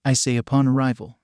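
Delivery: ordinary delivery, nothing marked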